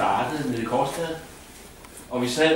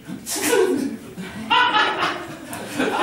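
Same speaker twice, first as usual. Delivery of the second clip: high breathless laugh; 6 dB louder than usual. Real, not acted. Narrator bursts into laughter.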